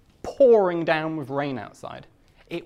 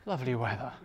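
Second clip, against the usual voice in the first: softer, puzzled repeat of Lovely weather; baffled echo; 3 semitones lower, 6 dB quieter, much faster than usual. Retold or imitated. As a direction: echo the words back, not getting the joke. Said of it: "she looked at me just like".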